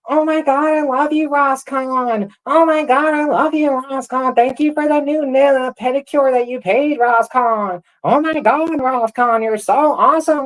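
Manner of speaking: very high, squealing fangirl impression; mock gushing fan. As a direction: gush in a mocking high, loud voice. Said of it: "idiots like Rue coming into this community".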